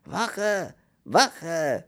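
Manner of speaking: high, loud, drawn-out call; shouting for help. A narrator's reading. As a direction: yell for the guard through a cell door. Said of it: "und schrie". (laut nach der Wache schreien)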